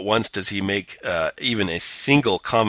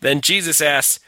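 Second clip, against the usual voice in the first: ordinary delivery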